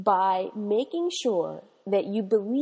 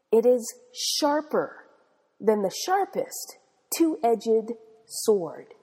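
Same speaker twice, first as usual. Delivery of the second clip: measured scripture reading; slow emphatic reading; 2 semitones higher, slower than usual. Acted. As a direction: slowly lean into each word. Reading the Bible verse.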